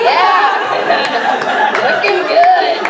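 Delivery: loud, high, drawn-out whoop; self-admiring cheer. Retold or imitated. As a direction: cheer at your own giant picture. Cheering own huge photo.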